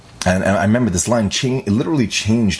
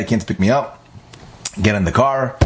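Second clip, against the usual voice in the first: ordinary delivery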